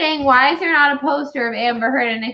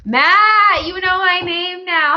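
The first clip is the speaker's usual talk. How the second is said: high, loud, excited hello; excited greeting; 8 semitones higher, 4 dB louder, slower than usual. Real, not acted. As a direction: greet Matt with delight. Greets the listener Matt.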